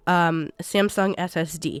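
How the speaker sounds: ordinary delivery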